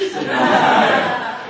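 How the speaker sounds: high loud burst of laughter; real loud laugh. Real, not acted. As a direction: crack up. Laughing at the phone call.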